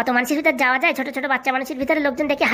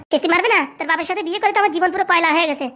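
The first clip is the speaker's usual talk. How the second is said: usual speaking voice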